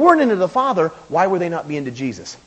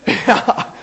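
breathy laugh; 3 semitones higher than usual. Real, not acted. Laughs at his own joke.

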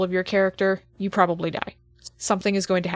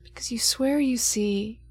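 game master scene setting; 4 dB quieter than usual. Acted. GM sets the opening scene.

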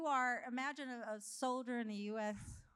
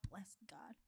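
whisper; 6 semitones lower, 17 dB quieter than usual. Retold or imitated. Praying alone at home.

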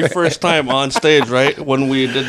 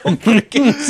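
laughing; 8 semitones higher, slower than usual. Real, not acted. Repeating code word, laughing.

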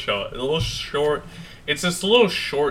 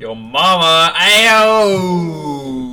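loud, high drawn-out holler; big playful yell; mock taunting shout; 5 semitones higher, 10 dB louder, much slower than usual. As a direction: shout it out and stretch the last word. Goofing, calling out a taunt.

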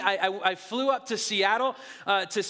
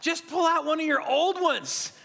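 put-on kids urging; 6 semitones higher than usual. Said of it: "they're saying".

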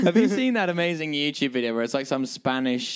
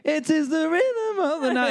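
much higher, slow put-on DJ voice; mimicked DJ; 11 semitones higher than usual. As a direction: croon the title like a cheesy radio host. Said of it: "the D J's like".

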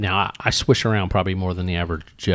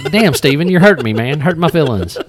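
laughing mock hurt; 5 semitones higher, 6 dB louder than usual.

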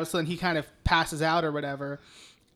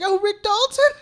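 starstruck gush; 13 semitones higher, 6 dB louder than usual. Retold or imitated. Sharon voiced, starstruck.

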